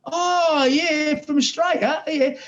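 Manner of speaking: high, bright oh of sudden recognition; a hammed-up penny-drops moment; playing his younger self recognizing a famous face; mock recognition. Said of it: "And I've gone".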